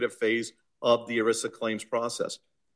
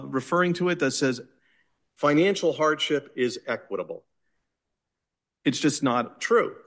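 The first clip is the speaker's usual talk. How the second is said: usual speaking voice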